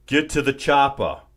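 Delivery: loud Austrian action-hero bark, Arnold style; a loud mock Arnold line; Arnold impression. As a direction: belt it out like Arnold in an action movie. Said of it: "He says it like that".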